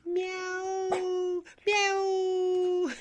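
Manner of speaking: high drawn-out mock meow; cat meow. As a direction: meow like a cat to tease the dog. Imitating a cat for the dog.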